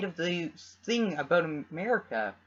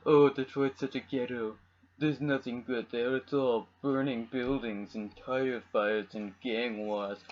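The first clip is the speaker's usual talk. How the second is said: mock snob; 5 semitones lower than usual. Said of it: "Sorry, I'm back on my accents".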